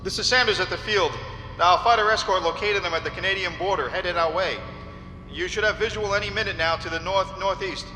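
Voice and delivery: high, loud voice over field radio; radio call. Acted. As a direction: report to the pilot over radio. Radio report from airfield to plane.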